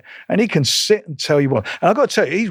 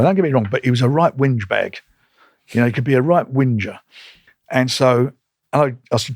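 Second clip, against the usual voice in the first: usual speaking voice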